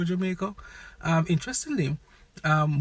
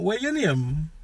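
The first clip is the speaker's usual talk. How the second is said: usual speaking voice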